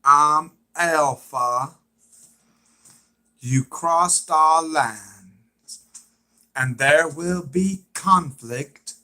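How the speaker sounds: loud, very slow proclamation; Alpha impression. Another try at Alpha warning of conflict, impression. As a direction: drag the words out forcefully. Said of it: "I'm trying to do an impression of".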